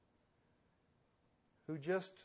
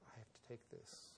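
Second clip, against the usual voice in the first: quiet, apologetic murmur; softly apologizing into the phone; apologetic hush; 3 semitones lower, 17 dB quieter than usual. Retold or imitated. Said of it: "apologetically said".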